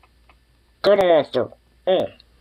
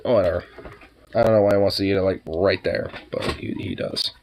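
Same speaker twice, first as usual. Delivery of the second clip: usual speaking voice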